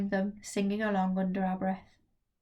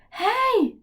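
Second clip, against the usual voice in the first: higher, louder stage whisper; 13 semitones higher, 7 dB louder than usual. Acted. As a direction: call out half hidden, half heard. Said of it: "a loud whisper".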